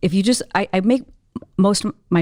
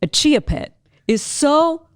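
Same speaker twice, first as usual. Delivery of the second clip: high, slow, drawn-out disbelief; comic emphatic rant; 9 semitones higher, much slower than usual. Retold or imitated. Speaker to tearful young lady, comically emphatic. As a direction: spell out the absurdity word by word.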